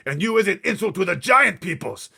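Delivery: high, very loud insulting shout; angry shout. Acted. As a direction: yell the insult in his face. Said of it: "shouted the blood bottler".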